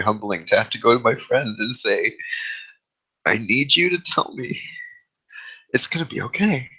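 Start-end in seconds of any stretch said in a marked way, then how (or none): 3.3-6.7 pleading for reassurance. Retold or imitated. Humbling plea to friend for reassurance.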